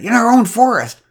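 high, loud, astonished; indignant declaration. A narrator's reading. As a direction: announce it like shocking news. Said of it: "she declared".

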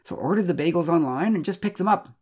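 high-pitched, pleading excuse; storyteller voicing a flustered husband; defensive explaining. A narrator's reading. Husband defensively explains his absence.

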